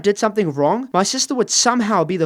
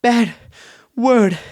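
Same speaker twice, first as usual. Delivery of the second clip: out of breath; 5 semitones higher, much slower than usual. Acted. Child runs to mum to show her the bad word, out of breath. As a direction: gasp for air between single words. Ran to mom, gasping between words.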